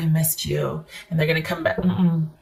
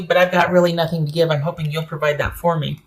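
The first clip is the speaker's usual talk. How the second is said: normal talking voice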